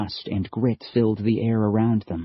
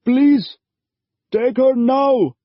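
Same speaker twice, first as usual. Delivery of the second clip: higher, louder, urgent father voice; father pleading, raised and strained; desperate father; 7 dB louder, slower than usual. A narrator's reading. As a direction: push her into the stranger's arms. Father begs.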